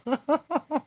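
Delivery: high, bubbly laugh; giggle. Real, not acted. Laughing at own hair on stream, high giggle.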